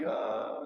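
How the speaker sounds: voiceless groan of defeat; no words left, just a breath; exhausted groan. Retold or imitated. November, no answer left.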